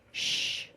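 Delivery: "sh" as a long unvoiced hush; S H sound. Shown as a demonstration. Teacher shows the mistaken sound, "sh" as a sustained hush. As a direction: hold "sh" with the tongue tip further back. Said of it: "hear that sound as an S H sound".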